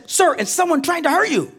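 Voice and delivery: urgent cop. Retold or imitated. Cop questions him urgently.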